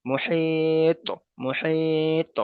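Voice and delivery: মুহিত very slow, the ত lightly bouncing back; qalqala demo. Shown as a demonstration. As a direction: let the ত of মুহিত echo lightly. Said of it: "আওয়াজটা হালকা করে ফিরে আসলো".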